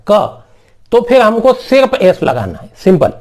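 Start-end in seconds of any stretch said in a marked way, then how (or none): 0.1-0.4 क like the letter K. Shown as a demonstration. Teacher showing the other CH sound, क as "k". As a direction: say क with a hard "k" sound. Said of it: "क करें, मतलब के की तरह".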